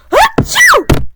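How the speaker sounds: loud, high-pitched achoo; explosive sneeze, far above the speaking voice; big acted sneeze. Pooh sneezing mid-question, loud and explosive.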